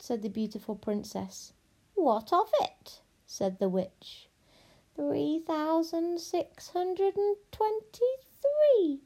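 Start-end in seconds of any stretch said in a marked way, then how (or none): 1.9-3.0 high, slow witch retort; dismissive witch. Acted. Witch answers the lost princess, dismissive. 4.9-9.0 high, drawn-out witch voice; witch counting. Acted. Witch counts pieces of gold, slow and absorbed.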